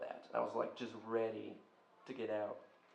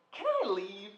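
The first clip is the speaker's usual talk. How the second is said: high, pressed "Can I leave?"; nervous plea; 8 semitones higher, 5 dB louder than usual. Retold or imitated. Student facing jail asks the chief, pitched up and anxious. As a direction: beg to get out of there. After being threatened with jail.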